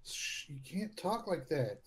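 low, soft, slow shush; soft, drawn-out scolding; hushing. Acted. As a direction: hush them, keep it down. Hushing an intruder near his pet.

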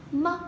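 "mất" said lightly and very short; just touch "mất" briefly; light short syllable. Shown as a demonstration. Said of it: "Đọc nhẹ thôi vì là âm ngắn". (đọc "mất" thật nhẹ và ngắn)

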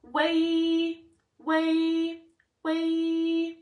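"Way" held on a high note, closing early into EE; loud sustained "Way" letting the ee sound swallow the vowel; Y takes over. Shown as a demonstration. Teacher showing the faulty vowel, "Way" sung mostly on ee. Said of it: "this is what that sounds like".

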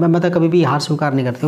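normal talking voice

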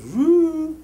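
high, loud whoosh noise; a quick whoo swooping upward; swooping woo. Acted. Lecturer miming a dancer's move, high loud woo.

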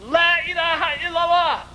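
high, ringing declaration of faith; retold yell. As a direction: cry it out in one sustained high line. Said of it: "he stood up and he yelled".

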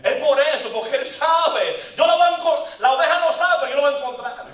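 loud, high preaching cry; preaching at full force. Real, not acted. Preacher to his congregation, a loud, high proclamation.

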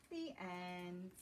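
gentle, lilting storybook read-aloud; reading to children. Acted. Teacher reading a picture book to young children, lilting. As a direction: draw little listeners into the story.

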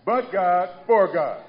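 "But" and "for" punched loud before "God"; stress on BUT and FOR. Shown as a demonstration. Preacher contrasting the verses for the church, each opening word punched. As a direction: hammer the little words. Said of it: "Y'all hear that?".

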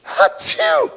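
high squeaky sneeze; fake sneeze. Mock sneeze in list.

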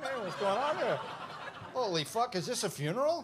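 high, put-on voice of something popping up; perky comic character. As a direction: pop up curious and startled. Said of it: "pokes its head up and starts looking around".

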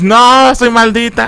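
high, hammed-up wail of despair; mock crazed yell. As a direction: imitate her yelling that she is cursed, pitched high. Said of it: "ah, grita, grita como loca". (grito fingido, agudo y desquiciado)

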